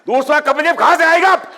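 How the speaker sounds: furious high yell, mimicking a cricketer; Kapil Dev. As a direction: explode into a loud, high-pitched mock outburst. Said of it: "he would get angry".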